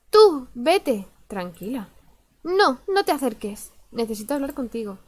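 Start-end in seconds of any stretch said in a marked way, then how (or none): 0.1-1.1 loud high shout to go away; angry shout. Acted. Amber voiced yelling at player. 2.4-3.7 scared. Acted. Amber voiced scared, pushing away.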